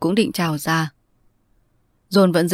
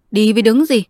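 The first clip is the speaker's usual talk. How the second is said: snapped, raised and louder; louder angry retort; half shout; 5 semitones higher, 6 dB louder than usual. Acted. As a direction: bark the question back at him. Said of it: "John nói như quát".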